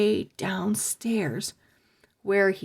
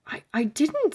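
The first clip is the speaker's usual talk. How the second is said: scared whisper; 6 semitones higher, faster than usual. A narrator's reading. Stink denying, scared.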